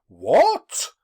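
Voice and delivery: sharp surprised exclamation; startled, indignant outcry; exclaiming. A narrator's reading. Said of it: "exclaimed the ghost".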